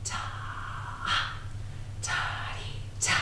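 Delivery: whispered rhythm syllables on the beat. Shown as a demonstration. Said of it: "echoing me back".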